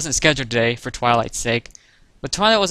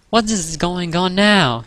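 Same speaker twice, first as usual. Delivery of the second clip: slow, higher-pitched exasperated complaint; complaining; 5 semitones higher, 4 dB louder, slower than usual. A narrator's reading. Twilight is interrupted by more yelling, complaining. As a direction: drag out the annoyed question. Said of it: "she complained".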